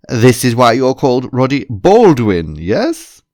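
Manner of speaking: loud, hearty character voice; pleased-with-himself boss, loud; jovial boss joking. A narrator's reading. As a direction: boom out a pun to the room. Boss jokes about the bald head.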